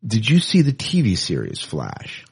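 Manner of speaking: ordinary delivery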